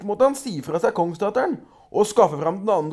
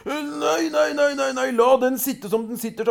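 pleading troll; 7 semitones higher than usual. A narrator's reading. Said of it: "sa trollet".

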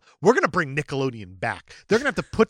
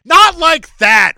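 high, slow, very loud mock yell; indignant shout; 12 semitones higher, 16 dB louder, much slower than usual. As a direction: bellow it like an offended warrior. Red Sonja rebuffing the kid.

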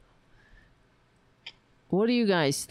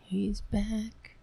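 mock-scared whisper; 3 semitones higher, 5 dB quieter than usual. Host whispering to viewers that her cat has returned. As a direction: whisper it as if the cat might hear.

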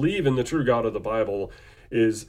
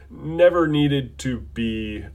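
normal talking voice

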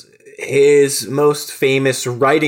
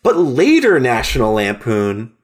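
ordinary talk

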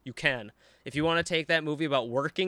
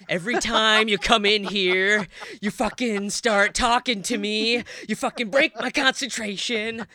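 raised, louder, hammed-up scolding; mock angry tirade; 5 semitones higher, 5 dB louder than usual. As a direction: play the irritated husband exploding at his wife. Acting out Jack's outburst at Wendy.

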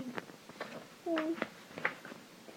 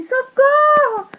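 very high, loud, stretched cries; screaming for help; 8 dB louder, much slower than usual. Acted. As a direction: scream it out running away. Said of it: "falando assim".

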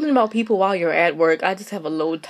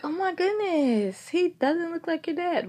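higher, slow mock gasp; mock surprised coworker; 8 semitones higher, 9 dB quieter than usual. Coworkers asking who her dad is again, mock surprise. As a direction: ham up a clueless coworker's surprise.

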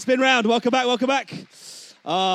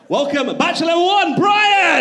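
big rising host welcome; announcer shout; 8 semitones higher, 7 dB louder, slower than usual. Acted. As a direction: hype the name up.

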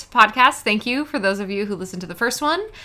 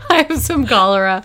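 laughing; 3 semitones higher, 8 dB louder than usual. Pretend. Laughing joke offering cholera.

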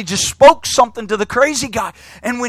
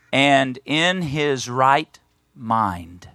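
"And in his right mind" said very slowly, each word weighed; slow emphasis; 8 semitones lower, 4 dB quieter, much slower than usual. Shown as a demonstration. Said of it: "I want you to notice these next five words".

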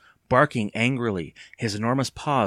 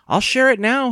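high, loud, eager offer; 14 semitones higher, 9 dB louder than usual. A narrator's reading. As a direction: jump at the chance to share. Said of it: "he said eagerly".

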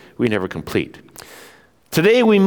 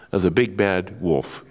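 normal talking voice

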